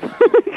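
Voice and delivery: short laugh. Real, not acted. Woman laughs at caller's silliness.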